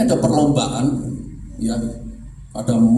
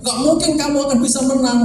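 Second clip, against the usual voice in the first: mock taunt; 8 semitones higher than usual.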